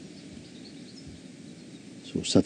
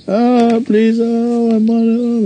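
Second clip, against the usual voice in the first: high pleading beggar's whine, much higher and louder; begging woman; 5 dB louder, much slower than usual. Retold or imitated. Beggar wife pleads with passers-by, high pleading whine. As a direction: whine "please" like a beggar on the street. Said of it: "like this, uh, begging".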